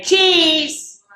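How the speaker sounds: high little mouse voice; mouse saying cheese. Acted. Punchline of the joke for the kids, high mouse voice. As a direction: squeak it out like a tiny mouse. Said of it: "You just say".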